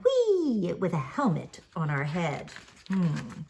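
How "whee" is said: high, playful squeal of joy; gleeful whee. A narrator's reading. Grandma reading to grandkids, a high playful whee. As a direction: squeal it like a kid on a bike. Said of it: "screaming and shouting".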